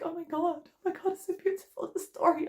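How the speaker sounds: high, mock-tearful awe; retold weepy gushing. Reacting to Brother Bear, mock-tearful gush. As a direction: pretend to be overcome, pitch way up. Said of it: "I was just crying".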